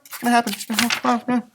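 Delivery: ordinary delivery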